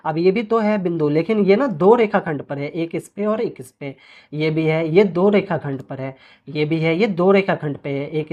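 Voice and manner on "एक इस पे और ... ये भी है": ordinary talk, nothing marked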